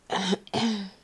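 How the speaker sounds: short, real throat clear. Real, not acted. Patient with a lingering cough, throat clearing. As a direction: clear the throat in two bursts.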